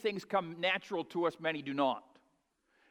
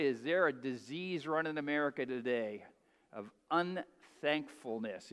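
normal talking voice

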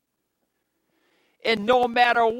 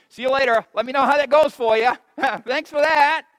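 louder, fast, flippant, then a laugh; mock brush-off; 5 semitones higher, 6 dB louder than usual. Preacher mocking a believer who abandons Jesus, flippant. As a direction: toss it off like a careless friend. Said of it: "and I'll say".

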